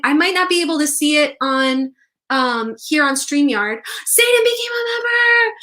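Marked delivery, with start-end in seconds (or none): 4.1-5.5 high, thrilled shouting of each name; excited squeals. Real, not acted. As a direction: cry out each new member joyfully. Members joining live.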